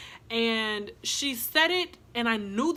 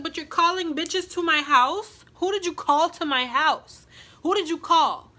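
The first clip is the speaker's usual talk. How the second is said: loud, raised-pitch demand; angry confrontation; 6 semitones higher, 7 dB louder than usual. Retold or imitated. Confronting her for calling people to the house, loud anger. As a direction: re-enact shouting someone down with questions.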